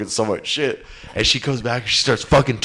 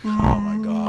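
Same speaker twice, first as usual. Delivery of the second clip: exasperated; 9 semitones higher than usual. Retold or imitated. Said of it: "And I'm like".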